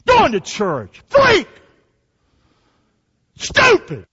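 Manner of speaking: retold angry insults; mad teen sneer. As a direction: snap it like a furious teenager. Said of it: "That's how I talk. Mad".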